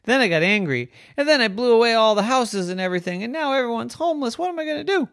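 high, fast, climbing fret; distraught confession. Acted. Harry lamenting the homeless people, distraught. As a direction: rush upward into a helpless wail. Wind frets over what he did.

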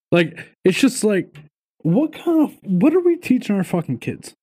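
incredulous rising rant; exasperated outburst. Real, not acted. Ranting to his co-host about what kids are taught, voice leaping high. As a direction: voice jumps way up in disbelief.

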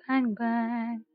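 ordinary talk

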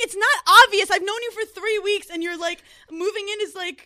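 high, worked-up retold objection; agitated retold argument; exasperated protest. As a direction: push back, starting loud. Retold argument about moving in.

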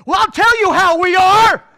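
loud, high exasperated outburst; mock furious reply. Teacher snaps at friendly parent, loud and high. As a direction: unload the truth on him. Imagined honest angry reply.

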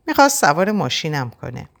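ordinary delivery

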